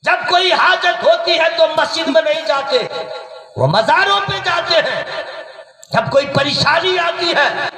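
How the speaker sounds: high, forceful sermon delivery; fervent preaching. Real, not acted. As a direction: preach it out to the whole crowd.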